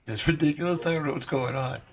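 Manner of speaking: usual speaking voice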